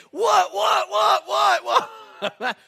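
high, loud rapid-fire "what"s; hammed-up high-pitched yelling; mock fight yell. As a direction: shout a volley like landing punches. Acts out pounding his friend.

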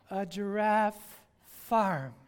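very slow, drawn out; slow disappointed reveal; deadpan slow punchline. Retold or imitated. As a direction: let the letdown land. Punchline reading the farm sign.

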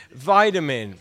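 VITAMIN with a long "eye" first vowel; first syllable of Vitamin rhymes with "tie"; American vitamin. Shown as a demonstration. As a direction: say Vitamin as VY-ta-min, American style. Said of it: "You say vitamin, I say vitamin".

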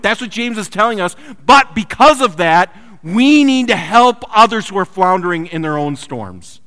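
loud, high impassioned preaching; fervent sermon climax. Acted. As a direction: drive the message home from the pulpit. Preaching at full force.

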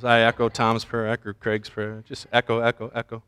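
low, hushed mock prayer. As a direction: murmur a pretend group prayer, fading on each echo. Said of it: "use that the next time I pray".

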